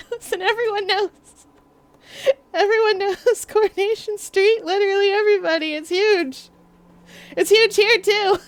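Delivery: high-pitched, through laughter; giggly insistence. Real, not acted. Streamer defending Coronation Street's fame to chat, giggly insistence. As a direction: insist on it while fighting back giggles.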